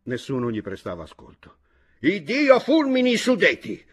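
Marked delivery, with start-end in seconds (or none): 2.0-3.8 very high, loud yelled curse. A narrator's reading. Chojnicki having just lost a game, yelling a curse. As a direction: yell it like a curse from above. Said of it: "urlò Chojnicki".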